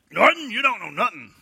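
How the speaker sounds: hammed-up Honeymooners bluster; Ralph Kramden. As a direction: yell at Norton like Ralph Kramden. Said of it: "my Ralph Kramden".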